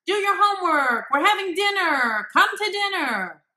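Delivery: high, loud barked commands; barking orders. Shown as a demonstration. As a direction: bark orders at a child. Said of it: "bark orders at you".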